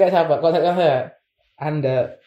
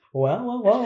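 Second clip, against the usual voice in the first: laughing; 7 semitones higher, 3 dB quieter, slower than usual. Real, not acted. Reacting to [name] idea.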